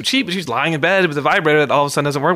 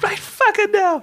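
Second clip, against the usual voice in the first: put-on very high, slow girly squeal; high squeaky demand, drawn out slowly; chick voice; slower than usual. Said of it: "That's my best chick voice right there".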